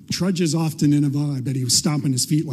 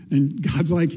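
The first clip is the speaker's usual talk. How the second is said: ordinary talk, nothing marked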